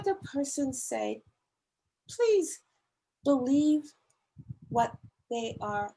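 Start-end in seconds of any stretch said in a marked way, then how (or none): 2.1-3.9 please pitched high, believe pushed out louder; an earnest appeal to believe people; pleading emphasis. Real, not acted. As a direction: plead each word slowly, with weight. Pleading with the congregation.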